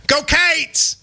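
"Cats" punched, with a high falling cheer; "Go Cats!" as one sharp syllable, shouted up; Kentucky fan call. Shown as a demonstration. A Kentucky fan showing his cohost the cheer, a high punchy call. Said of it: "in Kentucky, we go".

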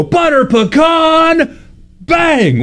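high, slow mocking call; imitating the Instagram guy. Son imitates a heckler's catchphrase, high drawn-out call. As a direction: stretch it out like a gleeful heckler. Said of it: "And then he'll go".

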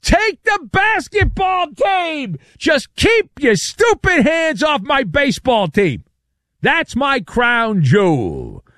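hammed-up high, slow desperate yelling; mock scream. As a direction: wail it like a panicked owner clinging to his team. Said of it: "he's screaming at the top of his lungs".

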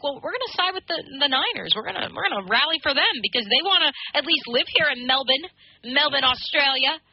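put-on a light Australian accent on a fans' quote; voicing Melbourne fans in an Australian accent. Host voices Aussie fans in an Australian accent. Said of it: "did you just give us your Australian accent?".

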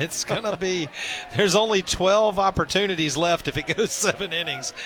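talking through a light laugh. Real, not acted. Co-host does the math on his failing pick, laughing. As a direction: laugh a little while working out the odds.

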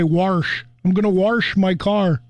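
"Wash" said as warsh, an R slipped in. Retold or imitated. Mimicking local folks who put an R in warsh. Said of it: "putting an R in wash".

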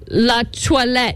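"la toilette" drawn out slowly, syllable by syllable; French pronunciation. Retold or imitated. Phoebe teaching Joey French words, slow French "la toilette". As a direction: say "la toilette" as a careful French lesson. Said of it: "teach him how to say these French words".

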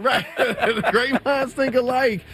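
high, slow laughing words. Real, not acted. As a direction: chuckle through it. Laughing repeat.

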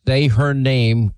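slow, deliberate, each word weighed; a grieving father's slow plea; emphatic demand. Retold or imitated. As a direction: press each word of SAY HER NAME. Demanding the governor say her name.

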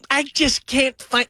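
high, slow whine; mock helpless parent. Host likening Dabo to a helpless parent, high and slow. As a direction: drag it out like a lost parent. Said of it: "that's him. That's Dabo".

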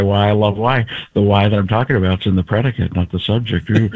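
Jeff Goldblum. Acted. Comedian explains why he loves why, musing impression. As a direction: muse quickly over the grammar, low. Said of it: "Like a Jeff Goldblum".

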